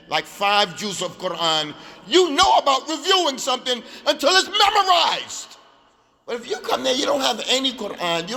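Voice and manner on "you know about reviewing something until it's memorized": high, loud insistence; emphatic point-making. Real, not acted. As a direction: push the point out forcefully. Teacher stressing the memorization habit.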